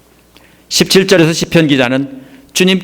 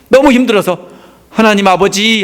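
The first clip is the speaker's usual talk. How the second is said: higher-pitched cry to God, imitating exhaustion; mock lament; 7 semitones higher than usual. Preacher voices the psalmist calling on God in hardship, lamenting. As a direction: act out a weary plea to the Father. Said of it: "탄식하면서 찬양했다는 뜻이에요".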